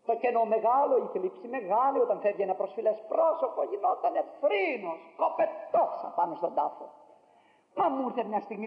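ordinary talk

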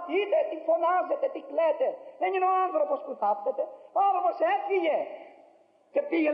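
high, fast, forceful call to the mourners; a preacher stepping forward at a grave, high-pitched urgent rebuke. Retold or imitated.

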